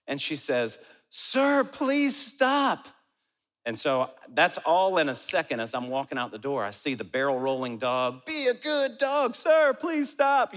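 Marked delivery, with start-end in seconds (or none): 1.1-2.9 high-pitched mock plea; high, exaggerated appeal to a stranger; imitated pleading woman. 8.2-9.3 high, slow mock scolding; hammed-up drawn-out command; imitated dog owner. 9.3-10.5 high, loud, slow mock plea; exaggerated high appeal; imitated pleading woman.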